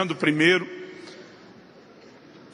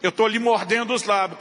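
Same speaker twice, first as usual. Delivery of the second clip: usual speaking voice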